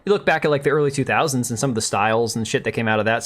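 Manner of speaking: normal talking voice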